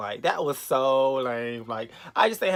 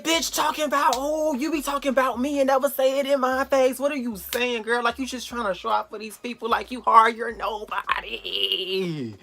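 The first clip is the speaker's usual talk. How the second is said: high, raised-voice tell-off; acted-out scolding, pitched way up; heated confrontation; 9 semitones higher, 4 dB louder than usual. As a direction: go off on her in a replayed argument, last word dragged out. Replays her confrontation with the girl.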